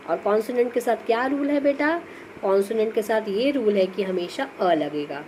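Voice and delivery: normal talking voice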